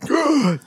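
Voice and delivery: sharp, high imitated gasp; mock shocked gasp. Sons' shocked reaction acted out.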